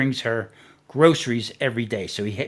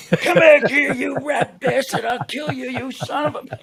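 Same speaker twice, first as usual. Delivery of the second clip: high, loud mock rage with laughter around it; high-pitched comic yelling; retold angry screaming; 12 semitones higher, 6 dB louder than usual. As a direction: replay a hammed-up threat at someone fleeing. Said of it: "I'm screaming".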